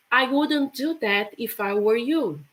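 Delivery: ordinary delivery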